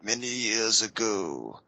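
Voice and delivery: grave movie-hero delivery; mock Ken Watanabe. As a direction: play the solemn remake star. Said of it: "Ken Watanabe spinning around".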